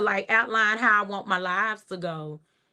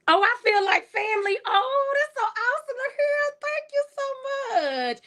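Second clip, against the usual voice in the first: gushing. Real, not acted. Host thanking a viewer who feels like family, gushing. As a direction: gush in a very high voice, nearly wailing with delight.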